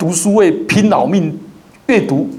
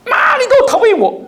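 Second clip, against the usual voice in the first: put-on much higher, laughing child's complaint; angry little child. Pastor mimicking a child accusing mum, in a high squeaky voice. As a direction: squeak it like a sulky toddler, half laughing. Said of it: "才四岁多就大骂她的妈妈".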